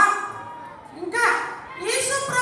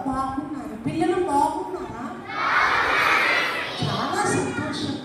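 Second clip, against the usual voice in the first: Beauty puppet; 8 semitones lower than usual. Pretend. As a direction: coax the children sweetly. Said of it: "ఈమె పేరు beauty". (Beauty బొమ్మ మృదువుగా పిల్లలకు చెప్తుంది)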